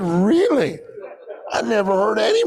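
amused disbelieving really; surprised laugh; laughing surprise. Retold or imitated. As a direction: laugh through the question as if it can't be true. Startled reply to George.

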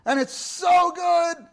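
slow, high and loud, savoring every word; joyful shout. Real, not acted. Preacher delighting in morning fellowship, a slow joyful shout. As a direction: burst out in delight, stretched slow.

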